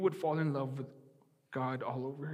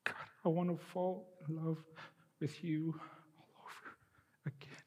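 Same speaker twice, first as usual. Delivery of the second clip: softer, fading, tear-choked; tearful prayer; 7 dB quieter than usual. Real, not acted. Pastor repeats his prayer to God, fading tearful. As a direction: trail away into quiet tears. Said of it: "So I'll say it again".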